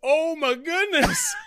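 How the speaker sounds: high, slow mock-fretful voice, laughing; impression of Camille. Co-host mocking Camille's voice after the lost recording, high and slow. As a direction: squeak it out like a flustered host. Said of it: "my new impression of Camille".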